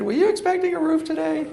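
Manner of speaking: high, curious neighbor at the door; neighbor asking. Retold or imitated. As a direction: ask it like a puzzled neighbor.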